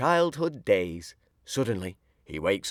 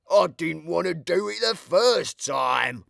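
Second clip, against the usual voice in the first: higher, slow rueful complaint; sulking over a bumped nose; grumbling; 6 semitones higher, 4 dB louder, slower than usual. A narrator's reading. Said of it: "says Burglar Bill".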